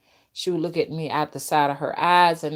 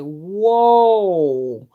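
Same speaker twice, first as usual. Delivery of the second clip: louder drawn whoa; stunned whoa; 6 dB louder than usual. Retold or imitated. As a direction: let it out like you can't believe it. Reacting to blood for spirits.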